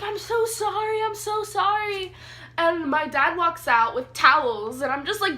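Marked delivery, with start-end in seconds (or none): 0.0-2.1 imitating the friend's apology. Friend apologising after peeing.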